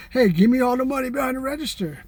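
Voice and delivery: half-joking robber voice; mock robber demand. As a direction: playfully demand the cash. Pretend store robbery.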